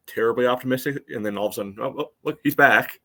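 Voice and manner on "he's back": normal talking voice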